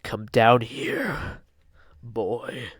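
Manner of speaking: put-on loom over the child and drag out each word; menacing wolf monster. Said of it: "He's like".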